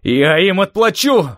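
loud, high, drawn-out vengeful yell; wild yell. A narrator's reading. Steve vowing revenge atop the pass, wild yell. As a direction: bellow it slowly, pitch climbing. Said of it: "Стив дико заорал".

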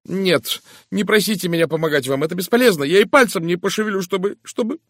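high, loud refusal that stumbles to a halt; agitated, then faltering. A narrator's reading. Miss Howard refuses to help Poirot, agitated and faltering. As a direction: protest heatedly, then get stuck. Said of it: "Она запнулась и окончательно умолкла".

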